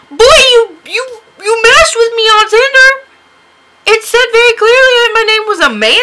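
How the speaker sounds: high, loud outraged retort; indignant mock yell. Acts out indignant reply to match.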